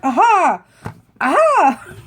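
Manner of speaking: loud, high pretend eureka; sudden realization. Vlogger imagining she remembers the forgotten name, mock eureka cry. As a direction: burst out as if it just came to you.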